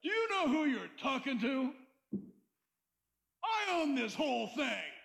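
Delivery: lightly voiced self-important big shot; mock the boastful with a hint of swagger; imitating a full-of-himself nonbeliever; cocky worldly people. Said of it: "People are like".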